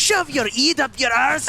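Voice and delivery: pretend-furious Scottish-Irish yell; mock Scottish-Irish shout. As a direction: curse in a thick put-on Scottish-Irish accent. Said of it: "A mix of Scottish and Irish".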